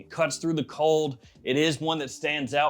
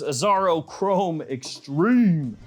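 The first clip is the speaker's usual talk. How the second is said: slow, louder grand product announcement; announcing; 4 semitones higher, 6 dB louder, much slower than usual. Retold or imitated. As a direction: announce it like a headline. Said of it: "The Neck Pump says".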